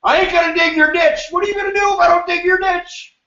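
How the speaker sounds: high, shouting mock laborer; defiant worker. Voicing an unskilled striker defying the boss, shouting. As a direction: throw it back like a cocky ditch digger. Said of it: "a union of unskilled workers".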